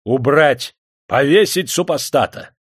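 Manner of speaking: loud, high furious command; officer's angry bellow; wrathful order. A narrator's reading. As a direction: shout the execution order. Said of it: "гневно выкрикнул майор".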